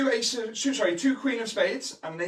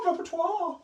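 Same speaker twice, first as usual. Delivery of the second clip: mock posh; 11 semitones higher than usual. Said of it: "that's a very posh word".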